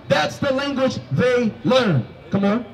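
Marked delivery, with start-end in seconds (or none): none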